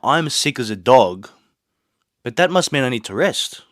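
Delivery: ordinary talk, nothing marked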